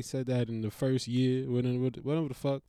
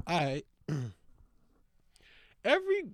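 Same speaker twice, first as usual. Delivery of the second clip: ordinary talk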